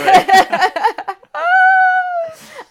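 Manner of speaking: high, loud burst of laughter. Real, not acted. Co-host losing it over a silly card, real loud laugh.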